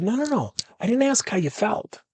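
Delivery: high-pitched, insistent interruption; cutting her off. Retold or imitated. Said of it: "I kept cutting her off".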